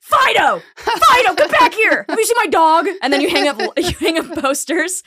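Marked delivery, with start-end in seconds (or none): 0.0-2.9 loud, high calls after a runaway dog; calling after a dog. Pretend. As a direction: yell the dog's name across a park. Acting out calling lost dog.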